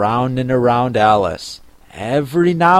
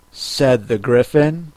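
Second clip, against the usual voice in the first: usual speaking voice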